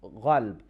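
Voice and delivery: "ghalb" with a gargled gh in place of q; Iranian ghalb. Shown as a demonstration. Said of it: "they say".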